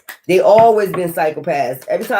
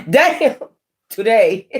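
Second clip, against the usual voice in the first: breaking into giggles; laughing damn; 3 semitones higher, much slower than usual. Real, not acted. Tarot reader cracking up at her own reading, laughing.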